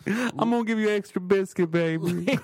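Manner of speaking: a hint of a loving big mama; imitating the Popeyes lady calling him baby; Southern mama voice. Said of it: "That's what she said to me".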